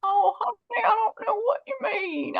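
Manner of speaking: put-on high, frail elderly woman; old lady voice. Shown as a demonstration. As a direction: play a sweet old granny. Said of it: "Now I want to hear your old lady voice".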